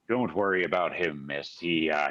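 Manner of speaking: put-on low, easygoing old-salt manner; reassuring sailor. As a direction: drop the pitch and soothe her. Boat soul reassures the frightened girl.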